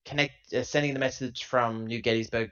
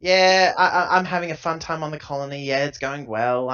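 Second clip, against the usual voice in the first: louder, breezy holiday chat; mock cheery update; 3 semitones higher, 9 dB louder than usual. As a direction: sell the fun time on the colony. Mock message to family.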